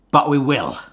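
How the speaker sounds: ordinary talk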